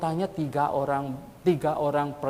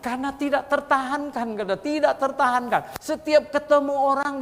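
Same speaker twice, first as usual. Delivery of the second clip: high, loud and bursting; can't hold the joy in; overjoyed; 11 semitones higher, 4 dB louder than usual. Retold or imitated. Convert explaining why he told everyone, high and excited.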